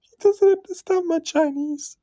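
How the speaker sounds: whispered, high giggly aside; laughing whisper. Real, not acted. Host laughing aside to the audience, whispered.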